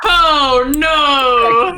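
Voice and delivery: high, loud drawn-out cry; loud wail of dismay; dismayed shout. Real, not acted. Player reacts to turn of events.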